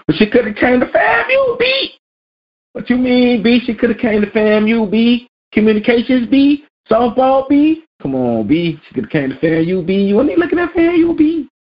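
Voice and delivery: squeaky high argument voice; high-pitched hammed-up bickering; high mock voice.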